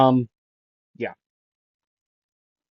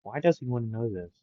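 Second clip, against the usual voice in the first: quieter, quick private thought, trailing off; inner thought, quiet; 9 dB quieter, faster than usual. Retold or imitated. Midoriya puzzled in his head at Todoroki, a soft quick aside. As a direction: mutter it to yourself, fast at the end. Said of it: "he would be saying in his head".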